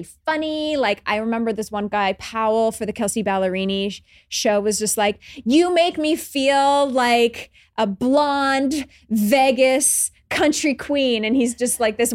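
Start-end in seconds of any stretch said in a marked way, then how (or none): 5.4-11.2 slow, drawn-out, higher and louder gush; gushing fan. Retold or imitated. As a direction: ham up a starstruck fan's gush. Gushing fan quote, hammed up.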